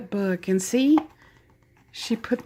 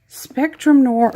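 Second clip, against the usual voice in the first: country accent slipping out, higher and louder; loud, drawn-out country twang; 6 semitones higher, 7 dB louder, slower than usual. Real, not acted. Reading a product name to viewers, country accent. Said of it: "My horrible country accent came out there".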